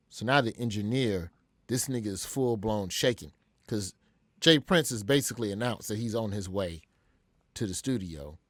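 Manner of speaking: ordinary delivery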